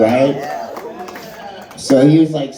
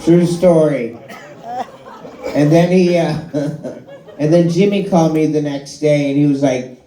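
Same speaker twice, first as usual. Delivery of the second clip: ordinary talk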